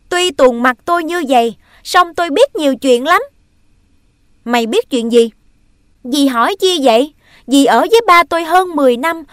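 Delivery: loud, high, fast; furious accusation. Acted. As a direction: hurl the accusation. Boy accuses stepmother.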